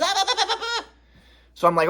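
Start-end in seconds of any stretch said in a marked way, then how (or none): 0.0-0.8 mock babble. Mocking babble after mom quote.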